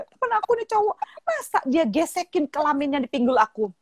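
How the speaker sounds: imitated schoolgirl, starting high and indignant; complaining daughter. Daughter tells mother what a boy did, imitated indignant complaint. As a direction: act out a daughter telling on someone. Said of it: "dia bilang".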